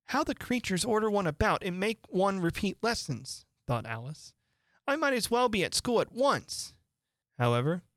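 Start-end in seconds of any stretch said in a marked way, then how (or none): 0.1-3.5 put-on high, huffy young girl. Alice complaining high. 4.9-6.7 put-on much higher, huffy girl. Alice high.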